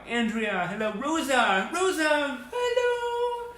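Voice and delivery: very high, delighted squeal of names; light up at seeing friends; excited greeting. Real, not acted. Host squealing hello to viewers in chat, very high.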